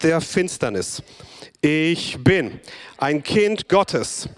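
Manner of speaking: call and response. Acted. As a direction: drive each phrase home for the room to echo. Leads call and response proclamation.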